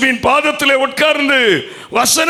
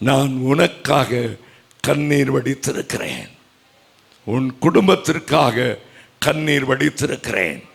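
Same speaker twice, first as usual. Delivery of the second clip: tender reassurance; 8 semitones lower, 4 dB quieter than usual. Acted. Jesus speaking to the grieving listener, tenderly. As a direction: comfort them gently.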